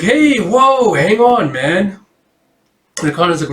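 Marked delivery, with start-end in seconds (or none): 0.0-2.0 high, loud call to stop; holding him back. Acted. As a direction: yelp at him to wait, much higher. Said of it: "He's like".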